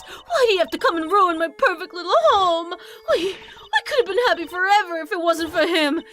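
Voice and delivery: laughing and crying at once, unhinged; manic breakdown. Acted. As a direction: crack up into sobbing laughter. Villain breaking down.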